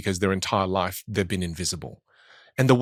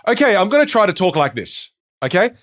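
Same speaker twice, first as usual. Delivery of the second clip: higher, louder eager student trying volume; 7 semitones higher, 4 dB louder than usual. Retold or imitated. Student trying out a louder voice for the first time. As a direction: overdo it like a keen beginner. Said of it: "So then they go".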